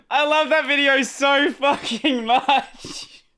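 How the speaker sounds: high, drawn-out words through real laughter; cracking up. Real, not acted. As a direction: gush slowly while recovering from laughing. Cracking up after funny video clip.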